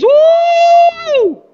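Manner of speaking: high, loud happy shout "Uh!"; excited wife's high whoop; joyful whoop. Retold or imitated. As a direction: cry out with delight like the thrilled wife.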